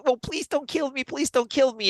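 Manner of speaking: high, begging goblin voice; pleading goblin. Retold or imitated. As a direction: beg for your life like a tiny goblin. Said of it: "like, really, like".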